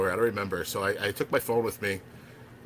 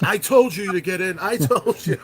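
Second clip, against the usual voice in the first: much higher, louder, slow triumphant gloating; gleeful told-you-so; 10 semitones higher, 7 dB louder, slower than usual. Retold or imitated. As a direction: crow it out, slow and high. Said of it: "she's like".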